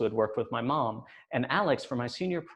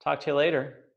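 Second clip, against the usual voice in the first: curt brush-off; 5 semitones higher, 4 dB louder than usual. Retold or imitated. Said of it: "I simply said".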